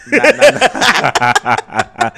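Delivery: drawn-out laughing no, very slow; slow giggly no-no-no. Real, not acted. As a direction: stretch it out while laughing. Laughing refusal.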